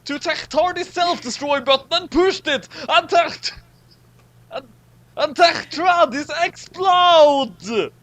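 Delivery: dramatic narration. A narrator's reading. Narrating the universe's end to the group, high and dramatic. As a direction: narrate like a breathless epic trailer.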